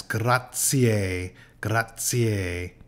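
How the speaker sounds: the z in grazie said as "ts". Shown as a demonstration. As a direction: hit the ts in grazie clearly. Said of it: "Z is usually pronounced like T S".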